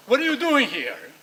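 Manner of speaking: sudden high, loud snap at the intruder; angry child yelling. Retold or imitated. Teen turning from the edge to yell at his parent, furious shout. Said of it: "And suddenly you go".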